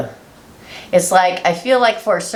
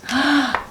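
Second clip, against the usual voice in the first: surprised gasp; 10 semitones higher than usual. Real, not acted. Card revealed.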